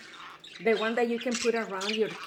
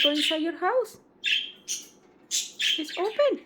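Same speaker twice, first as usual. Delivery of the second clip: very high sing-song coaxing voice; coaxing pet talk; 12 semitones higher, 5 dB louder than usual. Real, not acted. Owner coaxing Charlotte into her cage, high sing-song. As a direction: sweet-talk the bird back into its house.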